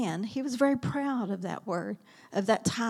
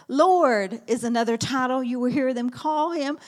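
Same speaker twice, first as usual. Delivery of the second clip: normal talking voice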